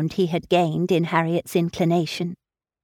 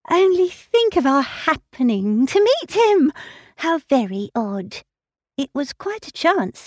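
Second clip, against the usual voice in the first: put-on a higher, breathless girlish voice, all aflutter; eager young Harriet; 7 semitones higher than usual. Harriet to Emma, excited about meeting Mr Martin, fluttering. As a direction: gush it out like a flustered young girl.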